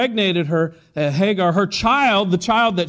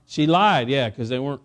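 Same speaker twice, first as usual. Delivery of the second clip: ordinary talk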